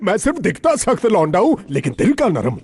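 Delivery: high-pitched, flustered pleading; flustered reassurance. Acted. Big man hastily reassures frightened guest. (घबराई हुई, ऊँची आवाज़ में दिलासा)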